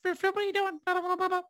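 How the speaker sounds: very high-pitched babbling cartoon voice; tiny high creature gibberish; squeaky game-creature mum. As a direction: voice a mum as an Animal Crossing creature. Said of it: "Sounds like one of those Animal Crossing creatures".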